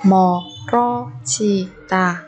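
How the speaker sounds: 멀어지다 slowly, each syllable apart. Shown as a demonstration. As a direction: break 멀어지다 into drawn-out syllables. Teacher models word syllable by syllable.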